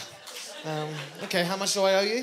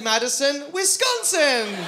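slow mock spelling-out; 5 semitones higher, 9 dB louder, much slower than usual. Mocking Americans' geography.

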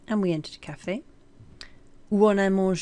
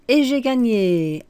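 triumphant; 5 semitones higher, 8 dB louder than usual. Acted. Triumphant win in the story.

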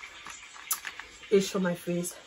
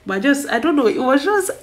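giddy excitement; 7 semitones higher, 9 dB louder than usual. Real, not acted. Flustered about crush.

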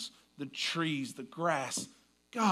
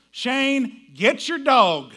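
higher and much louder, slow yelling call; wife's yell; 7 semitones higher, 13 dB louder, much slower than usual. Retold or imitated. Wife yelling at husband about the dog, loud call. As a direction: yell for Shane to get his dog. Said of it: "most famous phrase at my house".

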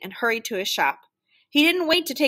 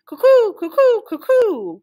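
high, loud cuckoo call; piercing two-note cuckoo hoot; whistling cuckoo clock; 12 semitones higher, 10 dB louder than usual. A narrator's reading. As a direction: pipe it like a clock bird. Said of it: "whistled the cuckoo clock".

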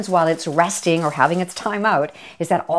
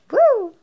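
high, loud "woo"; excited whoop; 6 dB louder than usual. Real, not acted. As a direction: let out a gleeful high whoop. Excited about the roast beef.

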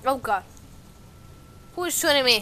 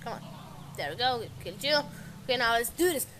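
ordinary delivery

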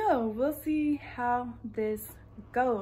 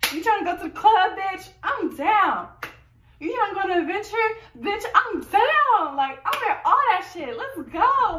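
hyped; 7 semitones higher, 5 dB louder than usual. Acted. Skit, hyped up going out.

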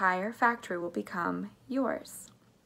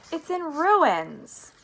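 high, very slow, loud wail; distressed cry; 9 semitones higher, 6 dB louder, much slower than usual. A narrator's reading. Mister Bucket laments his house, distressed cry. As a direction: wail it, stretching the words. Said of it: "cried poor Mister Bucket".

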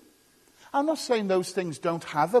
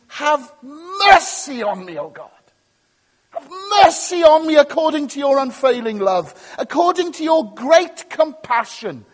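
loud, high, urgent plea to God; crying out; 6 semitones higher, 9 dB louder than usual. Shown as a demonstration. Preacher shows how David really prayed, a loud desperate cry. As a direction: beg with force, drawing out MERCY. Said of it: "He's not saying that".

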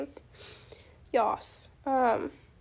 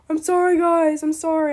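raised, louder plea to the viewers; loud apology; 8 dB louder than usual. Real, not acted. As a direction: call out the apology, louder and insistent. Apologising to annoyed traders.

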